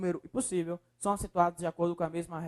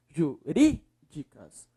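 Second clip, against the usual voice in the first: "Jurídicas" slowly, with RÍ punched out; stressed syllable; 3 semitones lower, much slower than usual. Shown as a demonstration. Teacher showing the strongest syllable, stress on RÍ in "Jurídicas". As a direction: lean hard on RÍ in "Jurídicas". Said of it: "Qual é a sílaba mais forte?".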